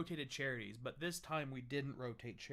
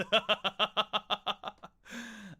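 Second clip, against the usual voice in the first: loud laugh; 7 semitones higher, 11 dB louder than usual. Real, not acted. Big laugh at own drink.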